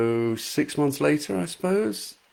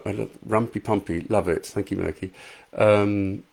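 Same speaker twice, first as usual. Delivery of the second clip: ordinary delivery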